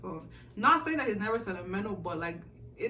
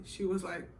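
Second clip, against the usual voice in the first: ordinary talk, nothing marked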